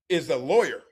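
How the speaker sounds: ordinary delivery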